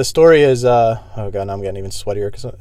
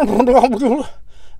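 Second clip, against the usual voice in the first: giggle; 12 semitones higher than usual. Real, not acted.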